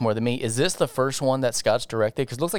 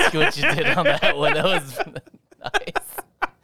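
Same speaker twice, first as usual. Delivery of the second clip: talking through real laughter; amused, words broken up by laughs; laughing; 5 semitones higher, slower than usual. Real, not acted. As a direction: crack up while getting the words out. Amused by co-host's remark.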